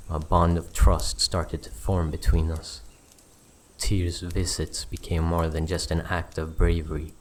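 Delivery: ordinary delivery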